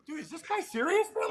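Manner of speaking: high, disbelieving imitation of a teammate; mock disbelief. Retelling a teammate asking if dad is serious, high mock disbelief. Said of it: "he goes".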